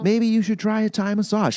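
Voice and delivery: high-pitched mom impression; mom's voice. Mom offers son advice, high imitated voice. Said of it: "she's like".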